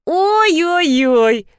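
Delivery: high, drawn-out mocking oy; exaggerated mock dismay; pulling a face. A narrator's reading. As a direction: sneer through a grimace, stretching each sound. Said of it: "скорчил рожу Гаврилов".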